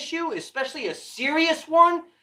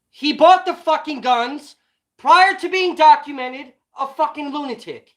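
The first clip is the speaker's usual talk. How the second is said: angry shout; 4 semitones higher, 6 dB louder than usual. Real, not acted. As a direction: yell it at people who won't listen. Angry rant at viewers.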